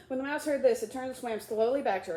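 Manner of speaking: plain reading voice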